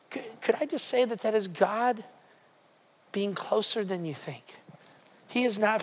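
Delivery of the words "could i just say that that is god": ordinary talk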